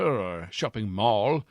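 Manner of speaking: ordinary talk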